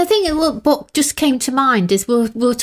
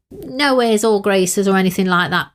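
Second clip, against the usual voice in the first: ordinary talk, nothing marked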